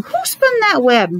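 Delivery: re-ask it as the owl. Retold or imitated. Explaining to the child, repeating the owl's question. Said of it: "He's saying".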